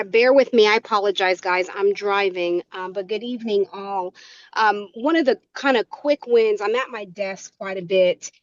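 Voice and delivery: ordinary delivery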